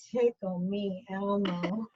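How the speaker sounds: slow, soft, breathy-sounding moan of the toy name; slow seductive murmur; sultry murmur. Retold or imitated. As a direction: purr it slowly like a come-on.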